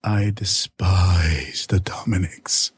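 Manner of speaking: slow, scornful whisper. Acted. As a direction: whisper your disgust slowly. Hushed confession of hatred.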